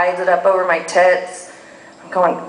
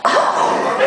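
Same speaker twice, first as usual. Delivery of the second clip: laughing oh; 13 semitones higher than usual. Retold or imitated. Both stunned.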